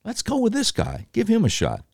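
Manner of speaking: high, soft, dopey voter voice; a high, soft gullible fan swooning. Retold or imitated. Crowd of voters swooning over Carter, high and soft. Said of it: "And people are like".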